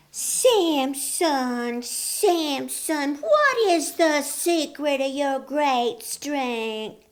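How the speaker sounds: high, slow coaxing woman's voice; sweet-talking Delilah. A narrator's reading. Delilah coaxing Samson for his secret, high and sweet. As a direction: coo each word to lure the secret out. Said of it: "Delilah asking again".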